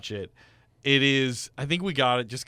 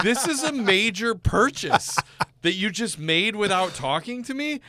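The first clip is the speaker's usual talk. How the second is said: high, slow, louder scolding wife; 9 semitones higher, 3 dB louder, slower than usual. Retold or imitated. Wife scolding husband over the car purchase, high and slow. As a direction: scold him for spending without asking. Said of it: "She's like".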